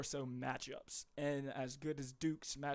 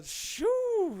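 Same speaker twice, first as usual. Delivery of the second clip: high breathy blow of relief; loud exhale; 13 dB louder than usual. Real, not acted. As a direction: blow out air, winded. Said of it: "out of breath after that one".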